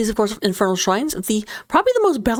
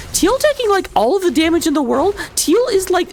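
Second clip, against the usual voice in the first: excited; 8 semitones higher than usual. Real, not acted. Caster hyping a fight.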